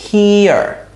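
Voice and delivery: হিয়ার stretched on a long high "hee"; pronunciation model. Shown as a demonstration. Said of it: "কিরকম হয় উচ্চারণটা".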